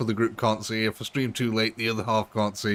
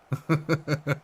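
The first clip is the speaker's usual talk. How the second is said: laughing; 5 semitones higher than usual. Real, not acted. Laughs after democracy quip.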